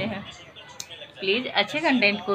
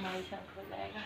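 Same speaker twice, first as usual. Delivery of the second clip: ordinary talk, nothing marked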